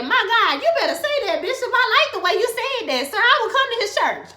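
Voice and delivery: high, loud hallelujah gush; hyped church reaction. Retold or imitated. Viewer shouts approval to the bishop, hyped and high-pitched. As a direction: gush like a thrilled congregant. Gushing reaction to preacher's words.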